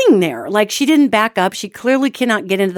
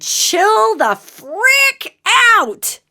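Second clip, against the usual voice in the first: high, stretched-out mock scream; retold scream; 12 semitones higher, much slower than usual. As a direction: act out screaming, drag out each word. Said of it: "but it was screaming".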